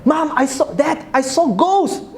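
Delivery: put-on high child voice, stretched at the end; frightened child. Child knocks on parents' door about a ghost, calling high. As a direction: cry out to your parents, pitched high. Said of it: "knock on the door of your parents".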